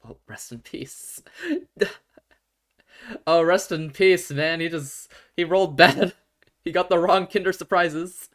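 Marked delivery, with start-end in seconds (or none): 1.3-2.0 short high burst of laughter. Real, not acted. Laughing at the story. 3.2-8.3 loud, laughing through the words. Real, not acted. Laughing at the story.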